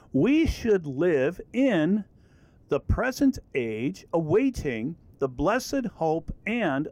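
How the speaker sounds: slow, deliberate scripture reading with separated phrases; word-by-word reading. Shown as a demonstration. Teacher reading literal interlinear English to students, slow and chopped up. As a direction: read each word out slowly and evenly, in chunks. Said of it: "I just read as each word was".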